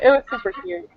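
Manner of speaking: ordinary talk, nothing marked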